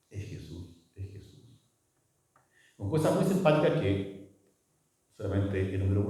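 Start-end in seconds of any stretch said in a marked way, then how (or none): 0.1-0.8 "Es Jesús" said low and much softer; quiet, reverent "Es Jesús"; hushed. Shown as a demonstration. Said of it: "repeated phrase, fading". 0.9-1.6 "es Jesús" whispered, no voice at all; a reverent whispered "es Jesús". Shown as a demonstration. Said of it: "repeat turns to whisper".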